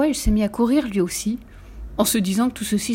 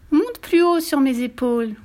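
higher, slow coaxing; sly voice; 6 semitones higher than usual. A narrator's reading. Fox lures the gingerbread man higher, sly voice. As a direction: lure them slyly, drawing out the words. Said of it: "d'une voix rusée".

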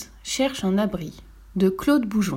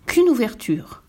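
usual speaking voice

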